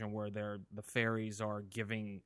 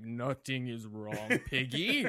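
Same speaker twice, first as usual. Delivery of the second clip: slow, hammed-up French accent, louder on the last word; bad French-accented Kermit; 2 semitones higher, 4 dB louder, much slower than usual. Evil Kermit reassuring Piggy in a really bad French accent. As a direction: imitate a badly faked French frog. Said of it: "a really bad Kermit 'cause he has a French accent".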